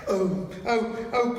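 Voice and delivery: high anguished cries of oh; crying out. A narrator's reading. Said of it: "cried the two girls".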